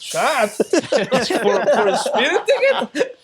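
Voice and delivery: high, loud incredulous laugh-talk; retold shock breaking into laughter; laughing disbelief. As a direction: blurt it out in amused disbelief.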